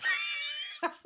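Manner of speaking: high-pitched burst of laughter; outright laugh. Real, not acted. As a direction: laugh out loud in a high peal. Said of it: "made me outright laugh out loud".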